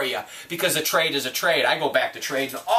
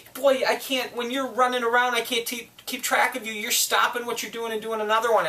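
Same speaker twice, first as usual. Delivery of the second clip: higher mock girlfriend voice, exasperated; imitating girlfriend; 7 semitones higher than usual. Girlfriend complaining he keeps switching tasks, higher mock voice. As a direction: play the fed-up girlfriend, pitched up. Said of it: "she said".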